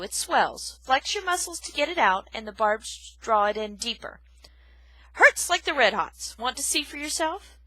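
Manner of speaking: loud, high gruff lecture; gruff old porcupine. Acted. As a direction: lecture her bluntly.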